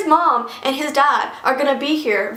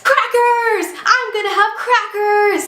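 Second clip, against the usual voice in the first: put-on child's gleeful yell; 8 semitones higher, slower than usual. Girl yelling on the stairs past her sleeping brother, high excited yell. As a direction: yell it out like an overexcited six-year-old. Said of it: "she yells".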